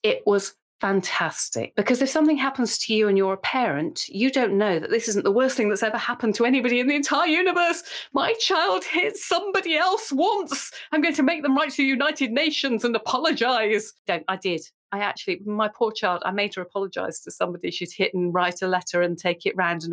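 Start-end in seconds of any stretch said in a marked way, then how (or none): 5.8-13.9 mock panic. Mocking own parental overreaction.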